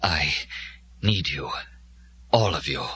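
low, halting creature with long gaps; from the depths. A narrator's reading. Dying Glimung appeals to Joe from the depths, low and halting. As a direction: drag each phrase up from deep down. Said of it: "From the depths of himself".

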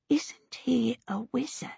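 scandalised whisper. Acted. Missus Baker lowering her voice to ask about the wizard, whispered. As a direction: drop to a whisper as if it's not nice to say. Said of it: "she coughed and lowered her voice".

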